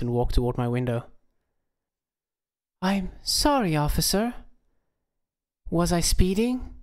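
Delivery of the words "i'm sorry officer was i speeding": higher, breathy-free ditzy flirt voice, slow on the question; bimbo imitation. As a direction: play the airhead caught speeding. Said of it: "doing my best bimbo imitation".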